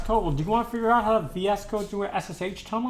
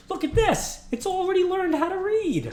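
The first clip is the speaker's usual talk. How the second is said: much higher, thrilled delight; amazed squeal; 13 semitones higher than usual. Real, not acted. Amazed model can read.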